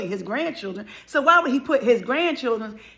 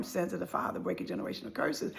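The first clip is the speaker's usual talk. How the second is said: soft, breathy hushed refrain; whispered refrain; 4 semitones lower, 10 dB quieter than usual. Real, not acted. Telling viewers of family sin, a hushed whisper. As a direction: breathe the words like a solemn truth.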